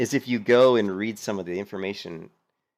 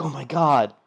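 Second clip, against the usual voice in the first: higher, louder mock panic; mock gasping guild members; 7 semitones higher, 6 dB louder, slower than usual. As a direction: ham up the guild's horror. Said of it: "They're like".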